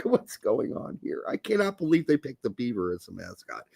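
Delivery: low, quiet aside to oneself; inner thought. Retold or imitated. Teacher privately doubting the mascot pick, quiet inner monologue. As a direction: think it aloud, low and under the voice. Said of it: "It's not in my mind. I'm like".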